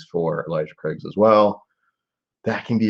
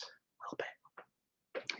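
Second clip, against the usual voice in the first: breathy whispered aside, slow and drawn out; 25 dB quieter, much slower than usual. Real, not acted. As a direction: let it slip out as a hushed afterthought. Aside while complaining about bonkers.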